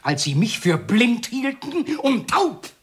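slow, climbing to a high cry; drawn-out, mounting outburst; bitter jealous accusation. Acted. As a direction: stretch each word, rising into fury.

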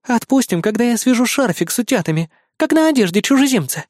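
high, whimsical demanding fox; capricious. A narrator's reading. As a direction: demand it coyly. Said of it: "капризно выдвинула своё требование Лиса".

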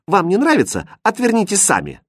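high, pert comeback; cheeky retort. A narrator's reading. Princess talking back to her governess, cheekily. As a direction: throw it back with insolent high pitch. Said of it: "дерзко ответила принцесса".

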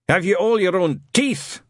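put-on loud, barking old Scot; gruff old sergeant. Shadwell questioning Newton, gruff and loud. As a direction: bark the question.

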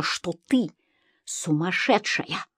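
slow, soft, dropped voice; hushed verdict. A narrator's reading. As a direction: let the words fall away slowly and softly. Narrator repeats the document's verdict. (тихо и медленно)

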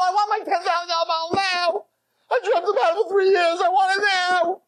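put-on high, crying drunken shout; tearful furious accusation; drunk outrage. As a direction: wail it like a cheated drunk. Drunk accuses staff of stealing.

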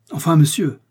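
indignant nobleman raising his voice in anger; furious outburst. Acted. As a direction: snap it out like an offended count. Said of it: "s'écria le visiteur très vexé, furieux".

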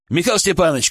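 calling out across the ambulance; shouting. A narrator's reading. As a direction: shout the name. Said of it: "крикнул я".

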